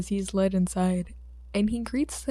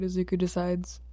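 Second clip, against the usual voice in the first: ordinary delivery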